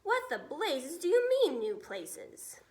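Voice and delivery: outraged air chief scolding the pilot; angry officer. Acted. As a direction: fume at the pilot. Officer angrily continues.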